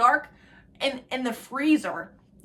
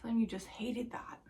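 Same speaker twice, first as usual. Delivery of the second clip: whispered aside; 9 dB quieter than usual. Real, not acted. Aside to viewers.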